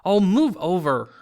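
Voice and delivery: loud impatient snarl. Acted. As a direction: shove him aside with your voice. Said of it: "Hermione snarled".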